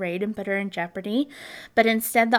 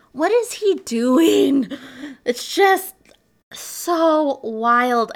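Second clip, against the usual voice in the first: baffled disbelief; 4 semitones higher, 6 dB louder, much slower than usual. Real, not acted. Baffled at killer's graveyard antics.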